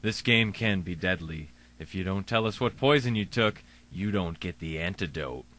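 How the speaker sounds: low, hushed, ominous; ominous low warning. Acted. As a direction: warn them in a spooky low voice.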